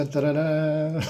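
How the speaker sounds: ordinary talk, nothing marked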